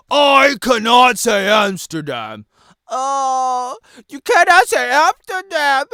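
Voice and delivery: slow loud mock complaint; mock exasperation. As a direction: stretch it out in mock disbelief. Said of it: "and then I could be like".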